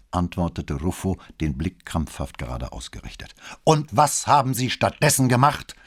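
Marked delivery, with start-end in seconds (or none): 3.6-5.7 loud, high, demanding question; stern. A narrator's reading. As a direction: interrogate the cadet sternly. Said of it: "in noch strengerem Ton".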